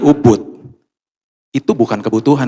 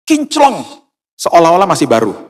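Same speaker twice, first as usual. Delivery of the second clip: amazed; 6 semitones higher, 3 dB louder than usual. Acted. Marvelling at shiny machine.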